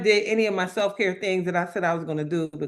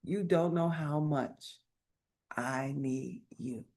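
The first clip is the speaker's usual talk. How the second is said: soft, slow imitation of a woman crying; retold tearful confession; 6 semitones lower, 9 dB quieter, slower than usual. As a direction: confide it slowly, fading lower and quieter. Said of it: "she started crying".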